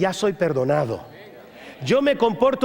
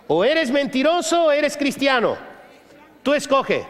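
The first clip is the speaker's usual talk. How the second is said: raised, insistent preaching voice; preaching hard; 4 semitones higher than usual. Acted. Pastor tells the church liars aren't Christians, raised insistent preaching. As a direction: drive it home to the congregation.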